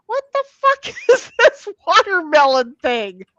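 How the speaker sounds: loud, high, laughing through the question; real laughter breaking into the words; laughing disbelief. Real, not acted. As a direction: crack up while asking what this is. Laughing at a weird search result.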